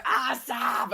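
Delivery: put-on high-pitched small child's cry; little child. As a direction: pipe up high like a little kid. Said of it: "there's a little child in a driver going".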